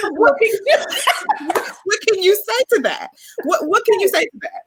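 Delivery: high-pitched, giggling disbelief; laughing punchline. Retold or imitated. Friend retelling a Pokemon meme's comeback, high and laughing. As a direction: crack up while delivering the comeback.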